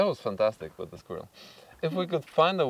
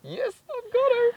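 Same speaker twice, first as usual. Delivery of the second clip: real triumphant excitement; 4 dB louder than usual. Real, not acted. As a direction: celebrate catching it on camera. Catching the squirrel on video.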